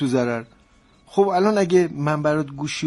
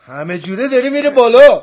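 high, loud burst of excitement; excited; 9 semitones higher, 12 dB louder than usual. Acted. Man to partner on the phone as price climbs, excited. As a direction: voice climbing sharply, much louder.

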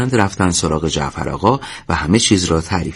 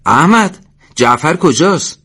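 loud, high-pitched shouted question; calling out; 8 semitones higher, 5 dB louder, slower than usual. A narrator's reading. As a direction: call across to a comrade. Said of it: "داد زد". (با صدای بلند)

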